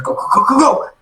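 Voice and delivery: high, urgent go-go-go; urging escape. Acted. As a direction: rush them along in a high voice. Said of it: "She goes".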